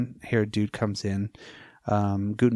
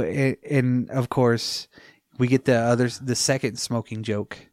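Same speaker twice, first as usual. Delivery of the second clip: usual speaking voice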